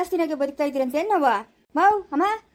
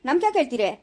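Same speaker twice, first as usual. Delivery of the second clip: ordinary talk, nothing marked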